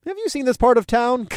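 high-pitched incredulous question; scoffing. A narrator's reading. Driver dismisses the redhead's worry about witnesses, scoffing. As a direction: scoff as if the worry were absurd. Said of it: "I scoffed".

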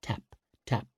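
plain reading voice

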